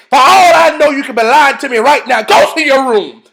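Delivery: high, loud acted scolding; angry mother shout. TV mother catching her daughter lying, angry shout. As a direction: explode like a mother sending her child off.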